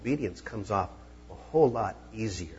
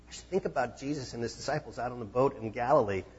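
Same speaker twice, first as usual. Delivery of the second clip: usual speaking voice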